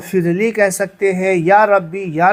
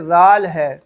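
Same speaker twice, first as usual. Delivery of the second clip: normal talking voice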